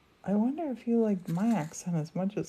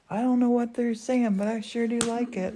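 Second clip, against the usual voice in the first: quoted waitress; 5 semitones higher, 4 dB louder than usual. Retold or imitated.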